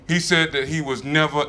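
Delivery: usual speaking voice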